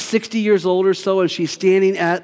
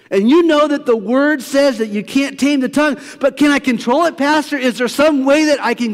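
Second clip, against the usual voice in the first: congregant's voice; 7 semitones higher than usual. Retold or imitated. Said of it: "what some of you are saying".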